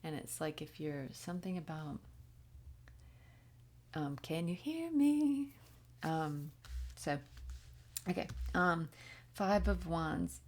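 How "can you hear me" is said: high, slow, drawn-out hail; canyon echo call. Retold or imitated. Someone calling into a canyon for an echo, drawn-out call. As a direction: imitate hailing across a canyon without real yelling. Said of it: "somebody yells into a canyon".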